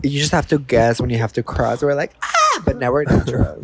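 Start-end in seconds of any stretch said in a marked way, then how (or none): 2.2-2.6 short, very high mock yelp; mock scream. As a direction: yelp in pretend panic at the traffic. Acting out crossing the road.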